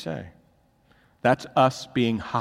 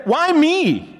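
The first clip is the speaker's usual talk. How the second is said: anguished cry; 7 dB louder than usual. Retold or imitated. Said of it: "Have you ever said".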